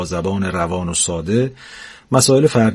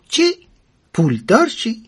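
much higher indignant retort; outraged old wife; 10 semitones higher than usual. Acted. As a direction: shoot the idea down. (پیرزن برآشفته با صدای خیلی زیر)

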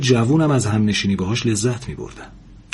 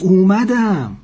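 high, louder, tremulous call; trembling voice; 9 semitones higher, 5 dB louder, much slower than usual. A narrator's reading. Old woman answering the door, in a trembling voice. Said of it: "با صدای لرزون گفت".

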